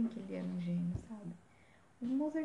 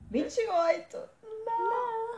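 dismayed cry; 12 semitones higher, 6 dB louder, much slower than usual. Real, not acted. Shocked at Heath Ledger's age.

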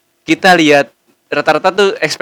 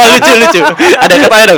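laughing; 8 semitones higher, 4 dB louder, faster than usual. Real, not acted. Laughing at photo.

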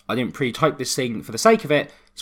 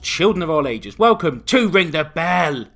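big booming showman intro; ring announcer; 6 semitones higher, 3 dB louder than usual. Acted. Opens show as ring announcer.

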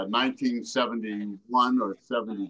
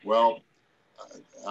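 usual speaking voice

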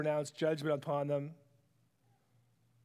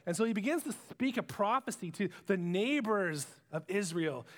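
ordinary delivery

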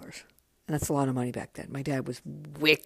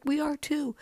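excited friends; 12 semitones higher than usual. Retold or imitated. Friends answering her excitedly.

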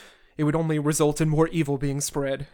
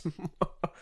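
soft chuckle; 10 dB quieter than usual. Real, not acted. Laughs at his own joke.

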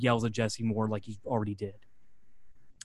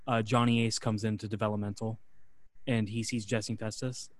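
ordinary talk, nothing marked

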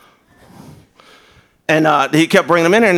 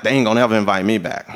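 fast worried thought; 8 semitones lower, faster than usual. Retold or imitated. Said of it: "And I thought".

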